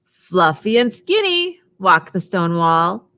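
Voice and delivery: slow, sing-song picture-book read; reading to children. A narrator's reading. Teacher reading Mama Cat to toddlers, slow and sing-song. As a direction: read it slowly for little listeners.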